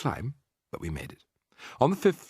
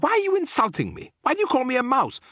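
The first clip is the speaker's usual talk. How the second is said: very high, loud squeal of offence; outraged high-pitched yell; indignant shout; 4 dB louder than usual. A narrator's reading. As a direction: protest shrilly at being insulted. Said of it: "he shouted".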